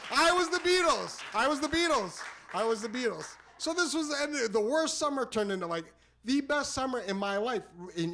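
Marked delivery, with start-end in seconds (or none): 0.1-2.2 high cry stepping down in pitch; exultant boast, easing. Real, not acted. Storyteller savouring his summer of fame, exultant then easing. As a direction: repeat it, gradually coming down.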